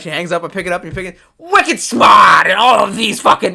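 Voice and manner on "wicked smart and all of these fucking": put-on loud, high Boston-accent yell; Boston accent shout. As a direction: yell it like the museum phone. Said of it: "It just yells wicked smart in your ear".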